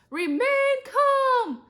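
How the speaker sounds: drawn-out, very high, loud scream. A narrator's reading. Teacher running with fire extinguisher, screaming. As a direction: scream it slowly, stretching the words. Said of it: "screamed Missus Dole".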